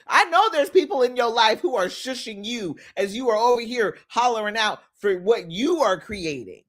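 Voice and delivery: normal talking voice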